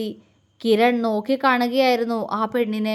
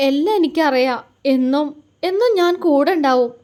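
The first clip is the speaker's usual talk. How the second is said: sobbing; 3 semitones higher than usual. Acted. Girl clinging to him, promising through sobs. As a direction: sob out the promise. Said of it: "തേങ്ങിക്കൊണ്ട് അവൾ പറഞ്ഞു".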